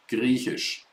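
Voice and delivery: hushed second take of Griechisch; quiet repeat. Shown as a demonstration. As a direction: murmur Griechisch again under the breath. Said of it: "Greek in German is Griechisch".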